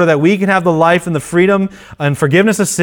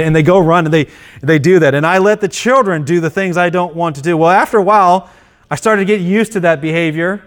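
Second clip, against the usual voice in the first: ordinary talk, nothing marked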